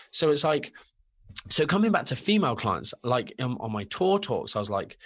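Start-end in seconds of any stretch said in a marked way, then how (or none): none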